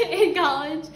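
bursting into a high laugh. Real, not acted. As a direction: laugh through the words. Laughing breaks into the wishes.

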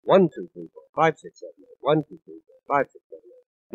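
"One" and "five" leaned on, "seven, eight" whispered; accents on one and five. Shown as a demonstration. Showing the normal accent on one and five. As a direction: hit "One" and "five" in the count. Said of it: "normal accent would fall on one and five".

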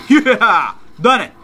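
loud, high whoop; real elated shout; triumphant cheer. Real, not acted. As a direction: celebrate finishing it. Celebrating the win.

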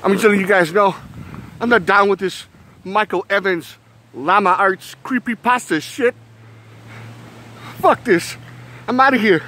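high, agitated protest; acted alarm. Telling viewers he is not down with this, high alarmed voice. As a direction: play it spooked, voice pitched well up. Parody, spooked and leaving.

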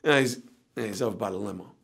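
low, very soft worn-out mumble; exhausted. Retold or imitated. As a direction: answer as if you have no energy left. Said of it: "I'm exhausted at this point".

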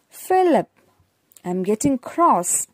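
"Philip" called out high and loud, then "I'm getting cross"; exclaimed. Shown as a demonstration. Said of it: "you have to read it astonishingly".

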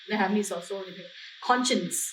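usual speaking voice

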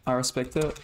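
normal talking voice

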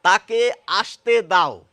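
তাকে আসতে দাও, loud and very slow, আসতে with sh; slow clear example. Shown as a demonstration. Teacher demonstrates an example sentence to the class, slow and loud. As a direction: spell out each word of তাকে আসতে দাও. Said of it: "বাক্যে প্রয়োগ করি".

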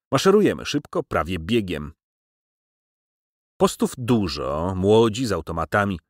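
ordinary delivery, nothing marked

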